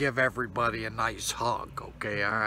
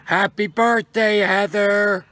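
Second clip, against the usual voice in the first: higher, louder happy birthday Heather; loud greeting; 10 semitones higher, 7 dB louder than usual. Real, not acted. Mother-in-law calling birthday wishes to Heather, loud. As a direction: belt out the greeting. Shouts greeting after partner.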